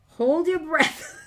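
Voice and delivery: giggling tensely at the key moment; nervous laugh. Real, not acted. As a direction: laugh nervously while bracing.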